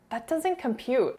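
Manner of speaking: high, slow mock bafflement; baffled viewer reaction. Voicing a viewer confused by Erica's words, mock bafflement. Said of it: "you're like".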